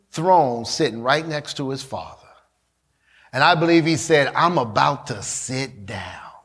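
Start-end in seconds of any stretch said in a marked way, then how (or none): 4.3-6.3 low, measured declaration; quiet resolve. Acted. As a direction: settle into a low, deliberate tone. Acts Jesus's resolve on cross.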